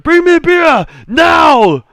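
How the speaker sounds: high, very loud roar; intimidating shout. Acted. Jay's character at the table shouts for beer. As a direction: bellow it like an impatient brute. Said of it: "shout to the bartender".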